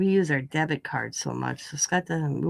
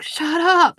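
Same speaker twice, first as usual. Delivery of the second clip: higher, louder mock yell; pitched-up snapping "shut up"; retold yell; 11 semitones higher, 10 dB louder than usual. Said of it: "I was like".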